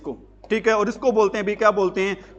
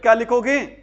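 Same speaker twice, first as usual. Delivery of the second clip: ordinary talk, nothing marked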